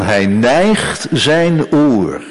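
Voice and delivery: very slow, drawn-out verse; slow, savoured quotation. Acted. Preacher quotes the psalmist to the congregation, slow and savouring. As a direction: stretch "neigt" out and let it fall.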